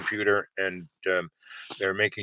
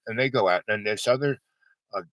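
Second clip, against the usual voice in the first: usual speaking voice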